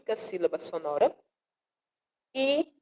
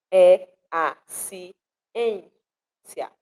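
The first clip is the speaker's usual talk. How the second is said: "é a ciência" very slowly, a pause after each syllable; counting out syllables; 4 dB louder, much slower than usual. Shown as a demonstration. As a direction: split é, a, ci, ên, cia apart. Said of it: "a gente vai contar". ("é a ciência" bem devagar, separando cada sílaba)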